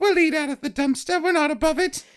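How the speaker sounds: high, pleading mock-orphan voice; squeaky urchin begging, nearly laughing; Oliver Twist. Hungry newcomers offer to eat scraps, in a high mock-orphan voice. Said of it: "Oliver Twist".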